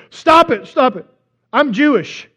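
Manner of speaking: loud, high mock protest; upset interruption. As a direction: cut them off sharply. Upset man cuts pastor off.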